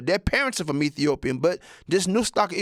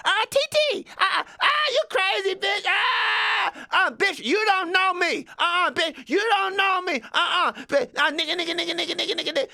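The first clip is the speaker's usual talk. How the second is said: put-on much higher, shrieking girl voice; screaming girl; 13 semitones higher than usual. Teen girl screaming at another girl on the bus, shrieking high. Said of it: "and the girls are worse".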